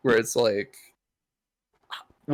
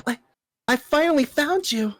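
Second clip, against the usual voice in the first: high, breathless relieved line; hero voice; 13 semitones higher than usual. Acted. Hero meeting the young man, speaking high and relieved.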